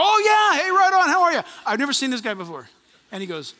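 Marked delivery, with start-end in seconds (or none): 0.0-1.4 high, loud burst of mock recognition; hammed-up hearty greeting. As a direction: greet a stranger like an old pal, loudly. Acts relieved greeting to the stranger.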